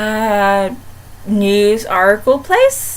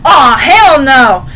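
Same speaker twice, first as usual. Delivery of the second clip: slow, louder drawn-out protest; mock outrage; 6 dB louder, much slower than usual. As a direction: drag it out like a jealous fan. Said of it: "I went like".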